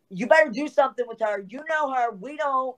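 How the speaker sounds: high, fast, insistent trainer voice; imitating Judy's demand. Judy telling her to sort Lisa out, high and pushy. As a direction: push it up and rush it like an impatient boss.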